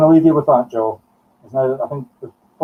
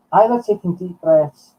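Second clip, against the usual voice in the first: normal talking voice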